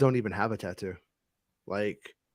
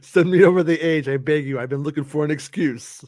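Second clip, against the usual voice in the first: Costanza impression; 4 semitones higher, 5 dB louder than usual. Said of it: "pretend you're George Costanza".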